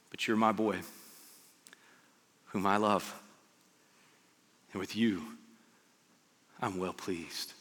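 low, hushed, with long pauses; soft fatherly words, phrase by phrase; tender reassurance. Acted. God to Jesus before any miracles, tender reassurance.

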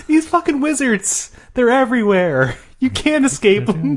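high-pitched hammed-up despair; high paranoid rant; mock panic. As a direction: warn that all is lost, comically. Mock panic about wizards.

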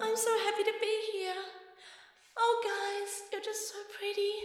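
high, airy, sugary sweet; dreamy distant voice. Pretend. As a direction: gush like you're in a dream. Sugary daydream fantasy scene.

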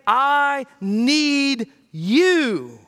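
drawn out heartfelt prayer; slow earnest plea. Shown as a demonstration. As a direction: say it slowly as a plea. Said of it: "when we say".

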